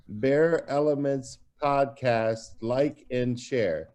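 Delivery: slow, spaced-out prompt; drawn-out, word-by-word request; slow dictation. Real, not acted. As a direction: dictate it slowly for someone to translate. Dictating a promo for translation.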